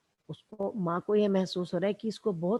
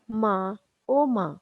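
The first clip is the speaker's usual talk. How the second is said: drawn-out groan for mother, slow; slow moaning cry; slower than usual. Retold or imitated. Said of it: "जोर से चिल्लाता है". (धीमे-धीमे कराहती पुकार)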